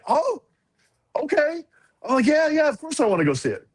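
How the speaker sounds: high-pitched, dawning excitement; surprised, then keen, voice up; retold eagerness. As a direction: replay a kid eagerly agreeing. Retells eager acceptance.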